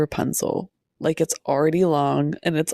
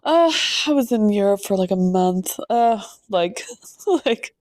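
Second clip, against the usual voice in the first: mock snooty student back from Europe, slightly higher; lightly hammed European accent; a hint of a put-on European accent; pretentious fake European accent; 4 semitones higher than usual. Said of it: "come back with an accent 'cause they're like".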